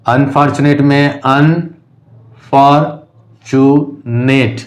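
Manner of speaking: Unfortunate broken into un, for, chu, nate with pauses; teacher splitting unfortunate into four separate beats; syllable by syllable. Shown as a demonstration. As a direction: sound out unfortunate slowly, one syllable at a time. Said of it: "four syllable का है".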